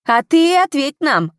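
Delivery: high, loud, slow demand; insistent angry call from the crowd; crowd shouting. A narrator's reading. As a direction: yell it accusingly at the official. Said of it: "кричали на него".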